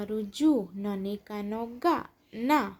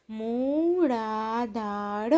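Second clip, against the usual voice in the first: મૂળાધાર very slow, every syllable held long; drawn-out મૂળાધાર, syllables separated; much slower than usual. Shown as a demonstration. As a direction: sound out મૂળાધાર slowly for beginner readers. Syllable-by-syllable reading, then whole word.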